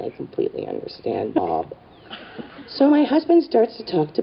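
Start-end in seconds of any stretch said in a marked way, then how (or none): 0.1-1.7 much lower and softer, subdued; deadpan, quiet acknowledgement; awkward low reply. Retold or imitated. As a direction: answer under embarrassment, dropping way down. Awkward reply to Bob in store.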